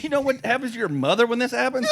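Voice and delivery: teasing the stepdaughter about her mother, laughing. Retold or imitated. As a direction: joke with the child through a laugh. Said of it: "I said".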